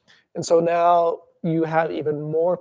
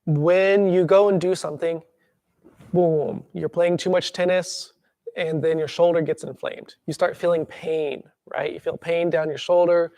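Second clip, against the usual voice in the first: usual speaking voice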